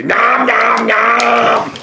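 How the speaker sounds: high goofy munching noises; nom nom chomping. Pretend. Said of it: "guys, goofiness".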